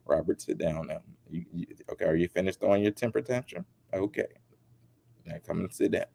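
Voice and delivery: soft, low, patient scolding; scolding interviewer. Retold or imitated. Interviewer calming a celebrity, soft mild scolding. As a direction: settle a child's tantrum. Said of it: "Like she was scolding a little kid".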